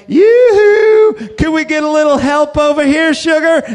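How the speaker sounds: high, sing-song come-on call; hooker catcall. Retold or imitated. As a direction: call out sweetly like a street hooker. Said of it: "three of them went like".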